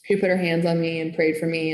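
normal talking voice

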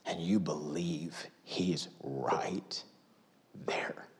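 slow hushed whisper. Real, not acted. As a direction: lean in and whisper it. Intimate sermon moment.

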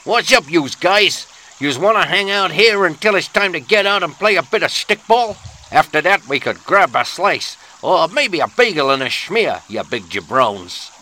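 put-on brash Brooklyn kid in a New York accent, inviting the guys to play. Host greeting yous guys in a New York accent. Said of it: "top-drawer dialect work".

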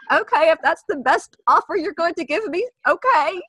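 high-pitched, meek little mouse voice; timid mouse. Acted. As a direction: play a hammed-up timid pushover, high and compliant. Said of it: "I'm like the timid mouse".

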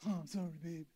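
mock offhand "Oh, I'm sorry, babe" said softly; husband giving his wife a hollow sorry; dismissive sorry. Shown as a demonstration. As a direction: toss it off as a careless apology. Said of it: "there's difference between saying".

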